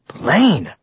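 a touch of warning in the name; growled warning. A narrator's reading. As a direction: say it as a cautioning growl. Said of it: "she growled".

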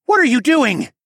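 high, loud outraged demand; demanding shout. A narrator's reading. Tawa shoving through the crowd, demanding shout. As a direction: shout it as a furious challenge. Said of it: "Tawa demanded".

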